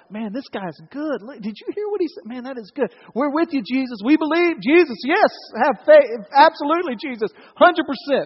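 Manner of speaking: high, enthusiastic agreement; gushing, rising to keen yeses; eager apostles. Acted. Apostles cheering on Jesus's teaching, eager.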